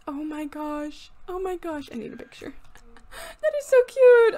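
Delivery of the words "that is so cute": much higher, much louder cooing squeal; gushing squeal. Real, not acted. Cooing over the little kitten, loud gushing squeal. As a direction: gush over something adorable.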